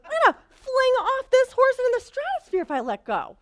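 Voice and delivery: loud, high, frantic rush; cling to the words in mock terror; panicked. Rider terrified of being thrown off, loud and frantic. Panicked thought on runaway horse.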